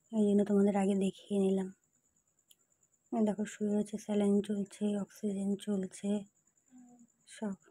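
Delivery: low, very soft voice; hushed. Real, not acted. Showing mother asleep in hospital, hushed. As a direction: speak under the voice beside a sleeper.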